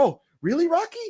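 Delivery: high, gushing imitation of fans; mock fan awe. Imitated fans marvelling at their hero, mock awe. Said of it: "people are like".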